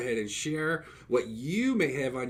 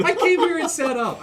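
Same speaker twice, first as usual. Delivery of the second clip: loud; 12 semitones higher, 5 dB louder than usual. Real, not acted. Mock argument about sponsoring.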